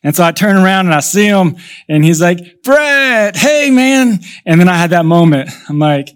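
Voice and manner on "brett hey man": higher-pitched greeting call; imitated calling out. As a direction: imitate a young man hailing someone. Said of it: "call out my name".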